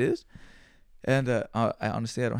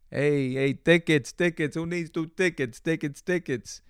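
mock ticket hawker; 3 semitones higher, slower than usual. Imagining the friend selling tickets, hammed-up scalper call. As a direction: hawk the tickets to a crowd.

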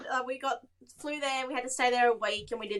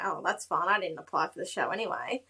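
lower, dismissive shrug of a reply; mock nonchalant; 6 semitones lower, 4 dB quieter than usual. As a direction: brush it off like you never cared. Retells shrugging off the rejection.

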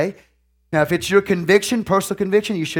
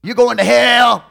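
slow, loud, higher accusing cry; in-your-face condemnation; 7 semitones higher, 7 dB louder, slower than usual. Pretend. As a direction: hammer him with judgment. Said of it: "Jump off in his face, tell him he's going to hell".

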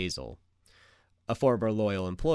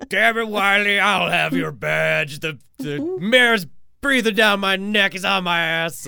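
loud, high blustering chief; gruff barking police captain; 7 semitones higher, 7 dB louder than usual. Retold or imitated. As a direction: yell like a harried boss. S0 joins the police chief bit.

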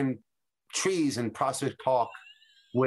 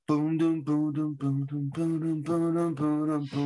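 beatboxing; slower than usual. Retold or imitated. Imitating music starting.

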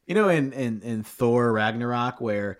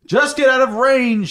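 high, loud call; 11 semitones higher, 7 dB louder than usual. Acted. Watcher calling to the group to flee. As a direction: call across to the others. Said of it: "he called".

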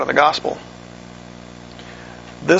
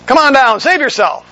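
mocking taunt; 8 semitones higher than usual. Crowd daring Jesus to come down, higher mocking taunt. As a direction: toss it up at him as a dare. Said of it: "One saying".

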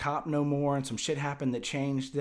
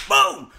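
shouted boom; loud boom; 16 dB louder than usual. Retold or imitated. Describing his fall, a loud shouted boom. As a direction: slam the word out like an impact. Sound of hitting the ground.

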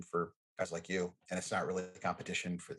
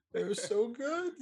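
much higher, slow, rising delight; squealing rave; slower than usual. Real, not acted. Still dreaming of those pies, a slow high squeal. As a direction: let it soar into a squeal of joy. Raving about remembered pies.

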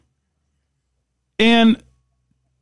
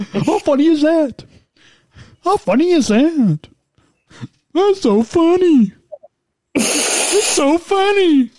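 funny different voice. Pretend. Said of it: "talk in a different voice".

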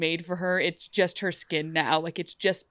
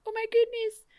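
delighted squeal; 14 semitones higher than usual. Retold or imitated. Emma delighted by necklace.

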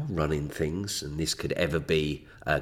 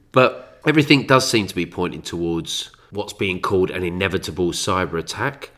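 ordinary talk

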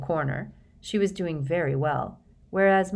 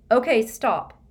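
raised voice calling a halt; shouting; 6 semitones higher, 7 dB louder than usual. A narrator's reading. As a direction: call it out loud over the room. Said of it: "Harry shouted".

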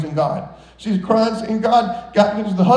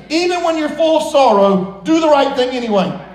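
full preaching; 5 semitones higher than usual. Acted. Preaching climax.

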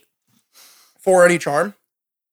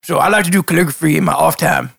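Toko voice. Acted.

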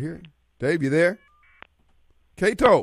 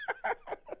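laughing; 16 dB quieter than usual. Real, not acted. Laughing hard at story.

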